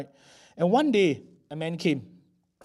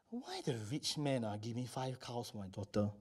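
private nagging thought; 4 semitones lower, 11 dB quieter than usual. Acted. Old father brooding on the sale, private nagging thought. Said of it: "thought at the back of his mind".

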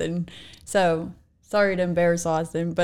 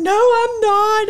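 higher, louder, slow blubbering protest; mock sobbing whine; 12 semitones higher, 6 dB louder, slower than usual. Mom imitating son sobbing in the car, slow mock whine. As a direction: ham up a crying kid's refusal. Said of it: "Sobbing in the car".

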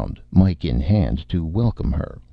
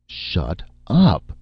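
understated angry shout, higher; violent shout; 4 semitones higher than usual. A narrator's reading. Director silencing the writer, mildly shouted. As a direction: cut him off with a mildly raised voice. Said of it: "Saint Cyr shouted violently".

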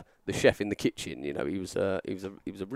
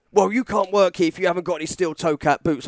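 imitated stern official; 5 semitones higher, 3 dB louder than usual. Retold stranger's repeated refusal.

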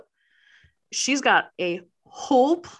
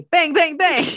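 loud, higher bang bang bang; mock gunshots; 7 semitones higher, 7 dB louder than usual. Retelling the shooting with gun noises. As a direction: punch out each shot.

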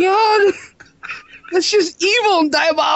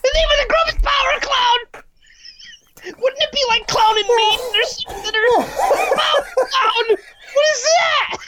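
laughing shout; 6 semitones higher than usual. Real, not acted. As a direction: yell it while cracking up.

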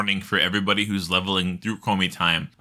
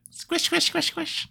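very high squeaky squeaks; squeaky high squish. Pretend. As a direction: squeak it like a toy. Squeezing gnome hair buns.

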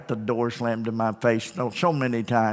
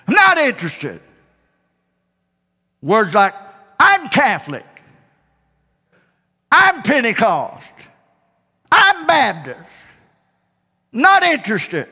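much higher mocking refusals; dismissive householders; 8 semitones higher, 4 dB louder than usual. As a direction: brush off the preacher at the door. Quoting householders refusing him.

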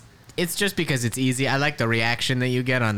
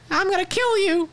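squeaky Ronald McDonald. Retold or imitated. Hamburglar imitating Ronald's threat in a squeaky voice. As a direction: squeak the threat like a cartoon clown. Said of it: "he doesn't sound like that".

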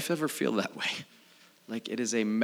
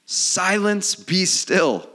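loud, raised command to the storm; big ringing scripture command; commanding rebuke; 9 semitones higher, 7 dB louder, much slower than usual. Acted. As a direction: order the wind down forcefully. Reads Jesus rebuking the storm.

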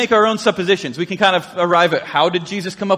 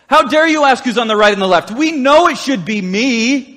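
louder, indignant, slowing on "me"; jealous disciples; 3 semitones higher, 3 dB louder than usual. Retold or imitated. Pastor voicing disciples who want the top seat, indignant. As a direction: grumble like a jealous rival. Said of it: "what they actually were probably going".

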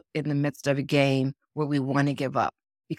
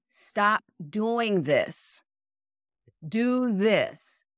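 emphatic instruction; 5 semitones higher, much slower than usual. Retold or imitated. Voicing what coaches were saying.

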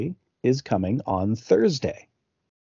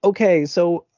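mock phone shouting; 8 semitones higher, 4 dB louder than usual. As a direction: call down the phone as if to someone hard of hearing. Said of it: "she really shouts on the phone".

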